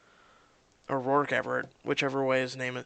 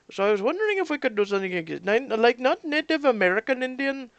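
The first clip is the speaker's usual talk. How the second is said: put-on Indian accent; 5 semitones higher, 3 dB louder than usual. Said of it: "I want to talk like this".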